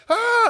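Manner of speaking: high yelped ah; a sudden high squeal as the pop-up jumps out; mock startled shriek.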